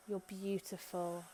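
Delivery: normal talking voice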